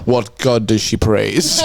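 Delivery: ordinary talk, nothing marked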